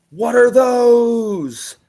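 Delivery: high, loud, drawn-out meme yell; old Vine meme. Retold or imitated. Podcaster imitating the Vine meme, hammed-up drawn-out yell. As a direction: shout it like the old Vine clip. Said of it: "maybe he'll just go".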